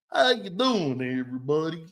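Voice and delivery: mock a put-on Southern accent; fake Southern accent. Host mocking a New Hampshire man playing Cajun, phony Southern accent. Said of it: "phony-ass accents".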